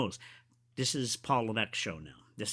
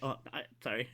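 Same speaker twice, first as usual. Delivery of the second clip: sheepish apology; 8 dB quieter, faster than usual. Retold or imitated. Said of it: "He's like".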